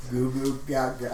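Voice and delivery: lightly voiced baby babble; slow, soft baby talk. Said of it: "Stop speaking their language".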